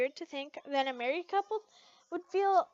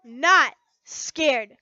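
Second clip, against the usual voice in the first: loud denial; 13 dB louder, much slower than usual. Acted. Lucy denies, heated.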